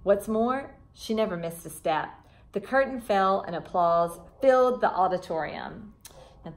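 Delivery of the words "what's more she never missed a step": plain reading voice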